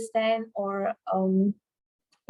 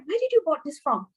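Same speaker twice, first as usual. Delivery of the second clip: one smooth unbroken run, higher pitch; fluent demo question, pitched up; fluent delivery; 6 semitones higher than usual. Shown as a demonstration. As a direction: rattle the question off without a pause. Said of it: "I can fluently say this".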